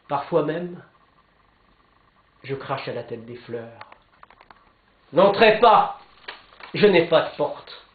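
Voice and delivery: declaimed verse with measured pauses between phrases; poem recitation. Acted. Speaker recites Jean-Pierre Remet's first canto to listeners, declaimed poem. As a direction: recite it line by line, rising in force on the pleas.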